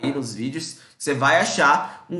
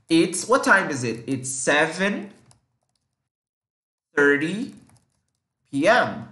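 each word slow and clear; slow teaching demo; slower than usual. Shown as a demonstration. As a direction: spell it out slowly for learners. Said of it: "Mas agora aqui são sete e meia".

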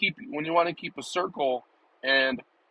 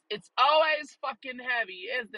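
mock high voice; 11 semitones higher than usual. Repeated line, high put-on voice.

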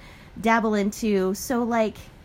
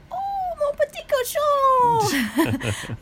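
high, drawn-out French cooing, imitating a teacher; 4 dB louder, much slower than usual. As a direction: gush it slowly, way up high, as a teacher greeting a pet pupil. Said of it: "she would be like".

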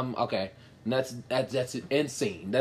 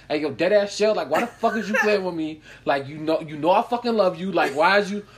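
mock New York boyfriend, lovingly tough; hammed-up New York guy scolding his girl; New York accent. New York guy to his girl Shell, mock tough accent.

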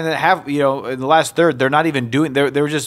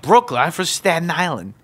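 indignant high-pitched New Yorker; New York accent; 6 semitones higher than usual. Retold or imitated. Staten Islander correcting a woman, indignant New York accent. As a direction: push back in a mock New York accent. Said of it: "New York accents".

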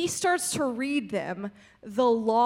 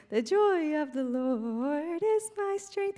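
slow, higher-pitched sing-song verse; Sunday school recitation; 4 semitones higher, slower than usual. Retold or imitated. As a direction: recite it like a kids' memory verse, drawn out. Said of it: "kids' Bible verse".

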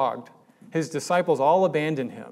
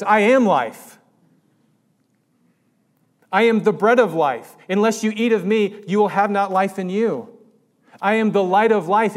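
loud, high, ringing proclamation; a pulpit voice raised to its peak; full-force preaching; 5 semitones higher, 6 dB louder than usual. Acted. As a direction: preach each "I am" like thunder. Preaches Jesus' words at full force.

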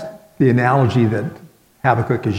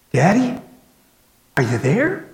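put-on a higher, small scared child's voice; little girl's voice; 7 semitones higher than usual. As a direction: call out like a frightened little girl. Little girl calls in dark.

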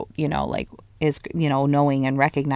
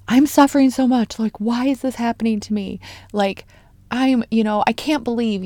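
higher-pitched, put-upon lament; mock complaining; 6 semitones higher than usual. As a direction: plead it like someone feeling sorry for themselves. Acts out our complaints to God.

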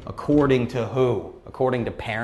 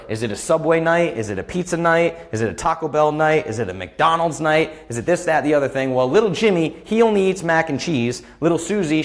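higher and louder stressed parent listing options; imitating a frazzled mom; 4 semitones higher, 4 dB louder than usual. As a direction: play a harried mother juggling meals. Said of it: "what life used to be is".

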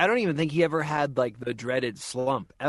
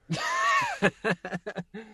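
creature screech. Shown as a demonstration. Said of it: "Show off".

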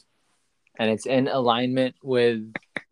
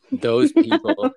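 mocking emphasis; 7 dB louder than usual. Real, not acted. Mocking churchgoers with a jab.

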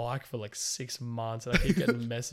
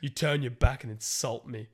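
raised, louder mock-outraged telling-off, slowed in places; hammed-up fury at Megan for calling his line cringe; indignant rant read out; 3 semitones higher, 6 dB louder than usual. Reading Aaron's reply aloud as a pompous, outraged takedown. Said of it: "he fucking loses the plot".

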